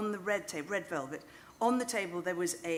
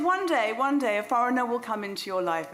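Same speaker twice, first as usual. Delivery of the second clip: high, loud mock-Irish fortune-teller; half-hearted Irish accent, pitched up and emphatic; psychic's Irish accent, badly attempted; 5 semitones higher, 7 dB louder than usual. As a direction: proclaim it in a rough Irish accent. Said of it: "imagine I'm talking in an Irish accent".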